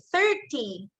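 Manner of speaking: "thirty" stressed on THIR, falling on ty; stress on first syllable. Shown as a demonstration. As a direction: punch the start of "thirty". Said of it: "so I gave the stress now on the first".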